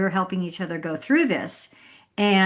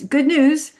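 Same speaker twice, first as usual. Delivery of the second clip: cheery doctor; 7 semitones higher, 7 dB louder than usual. Retold or imitated. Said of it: "But the doctor goes".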